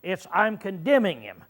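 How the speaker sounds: ordinary delivery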